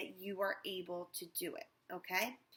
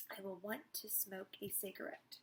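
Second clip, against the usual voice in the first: soft trance echo; 10 dB quieter than usual. Acted. Hypnotized woman repeating.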